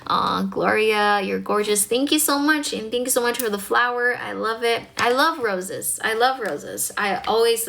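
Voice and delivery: ordinary talk, nothing marked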